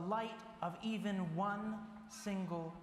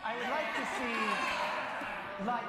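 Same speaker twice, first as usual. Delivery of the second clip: slow, louder, half-laughing cue; prompted audience reply; 2 semitones higher, 3 dB louder, slower than usual. Shown as a demonstration. Preacher feeding the congregation their answer, mock loud. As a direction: lead the crowd in its line, hammed-up. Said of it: "You're supposed to say".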